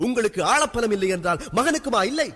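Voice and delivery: high-pitched angry question; heated, accusing outburst; indignant challenge. Acted. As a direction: flare up in offended pride. Son challenges father in quarrel.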